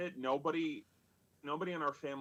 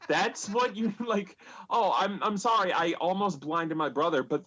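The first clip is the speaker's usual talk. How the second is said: higher and louder, mock-indignant disbelief; incredulous outrage; 6 semitones higher, 7 dB louder than usual. Real, not acted. Telling the audience what dad said, incredulous and laughing. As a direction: throw it back at him, exasperated and amused.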